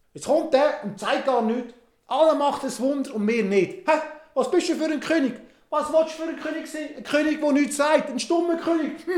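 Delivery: high, loud scornful taunting; mocking Herod. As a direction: sneer at the king who won't speak. Herod mocks the silent king.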